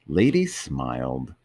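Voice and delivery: ordinary delivery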